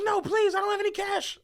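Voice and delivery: very high imitation of a pleading woman; retold frantic begging; mock panicked plea.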